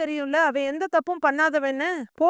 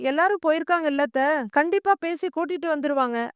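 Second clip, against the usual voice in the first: usual speaking voice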